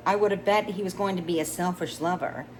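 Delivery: plain reading voice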